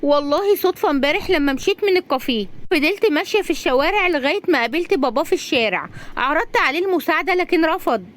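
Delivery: high, lively female-character voice; high-voiced heroine telling what happened; earnest young woman. Razan voiced in story.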